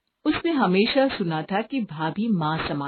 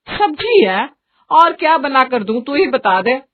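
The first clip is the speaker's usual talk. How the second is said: exasperated mother; 5 semitones higher, 7 dB louder than usual. Acted. Mother exasperated by fussy son.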